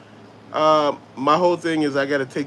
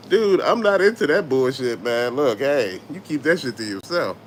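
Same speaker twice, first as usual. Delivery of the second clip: usual speaking voice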